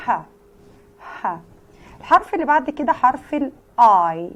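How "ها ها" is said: breathy h exhaled like catching breath; teacher modelling h as a pant; panting h sound. Shown as a demonstration. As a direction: puff out ha like after a run. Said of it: "هو ده نطق حرف الاتش".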